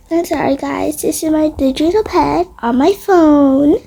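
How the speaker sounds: usual speaking voice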